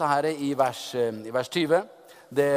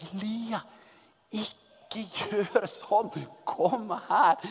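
pleading onlooker; 4 semitones higher, 3 dB quieter, much slower than usual. Pretend. Onlookers beg Elijah.

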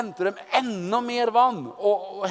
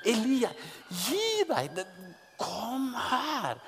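high, softer half-laughing plea; pleading onlooker; 5 semitones higher, 6 dB quieter, slower than usual. Pretend. A nervous onlooker urging Elijah, high and softer. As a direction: beg him to quit, amused.

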